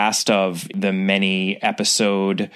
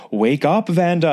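high, eerie ghostly call; 8 semitones higher than usual. Retold or imitated. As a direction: call out like a haunting spirit. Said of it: "this ghostly voice throughout the house".

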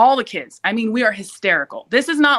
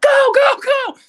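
high panicked yell, pushed loud; screaming; 4 dB louder, slower than usual. Retold or imitated. Urging her mom to drive off, panicked yell. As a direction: shriek at the others to hurry. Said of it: "that's when I started screaming".

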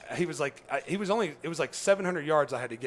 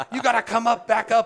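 imitating an angry guide, higher and louder; mock cussing; 10 semitones higher, 11 dB louder than usual. The guide yelling him back up the hill, mock angry. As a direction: bark it like a frustrated guide. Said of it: "He starts cussing at me".

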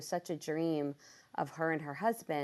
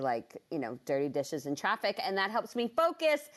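ordinary delivery

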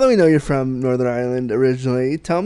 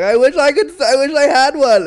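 mock envious whine; 11 semitones higher, 6 dB louder than usual. And I was like.

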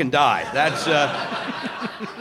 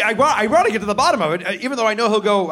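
normal talking voice

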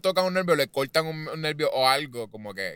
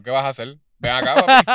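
mock taunt; 9 dB louder than usual. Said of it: "yo le digo".

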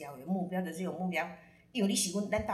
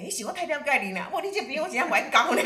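higher, louder, fast and laughing; laughing reply; 7 semitones higher, 9 dB louder, faster than usual. Real, not acted. [name] laughing as she answers her client, fast and loud. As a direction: get it out between laughs.

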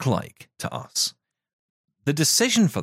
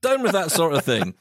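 laughing; 7 semitones higher than usual. Retold or imitated. Jokey outraged protest, laughing.

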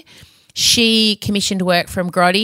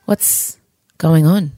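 usual speaking voice

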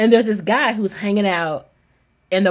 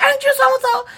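imitated yelling; 13 semitones higher than usual. Parking lot stranger pesters the speaker, mock yelling. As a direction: mimic a yell without the volume. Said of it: "like, yelling".

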